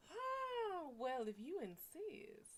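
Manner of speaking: high oh, then slow coy yield; coy mock acceptance. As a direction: play hard-to-get but give in.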